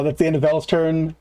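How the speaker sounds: ordinary talk, nothing marked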